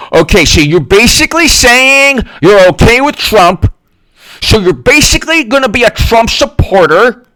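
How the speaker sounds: high, slowing, accusing whine; mock accusing supporter. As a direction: needle him like an online zealot.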